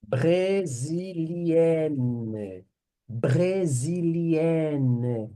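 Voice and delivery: Brésilienne very slow, stretching the enne ending; teacher stressing the feminine ending. Shown as a demonstration. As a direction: draw out the nne of Brésilienne. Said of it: "Essa daqui é a terminação para o feminino".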